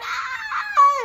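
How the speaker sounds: long, very high yell; loud scream. Retold or imitated. Acting out venting alone in the car, full-force high scream. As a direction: scream it all out at full volume. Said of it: "and I'm like".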